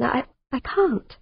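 slow, breaking with a sob; distressed, near tears. A narrator's reading. Amanda refusing Martin's necklace, voice breaking. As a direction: hold back tears as you refuse. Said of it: "genuinely distressed".

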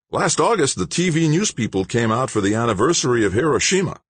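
a hint of a keen, lively protester; Don's voice. Don voiced by narrator.

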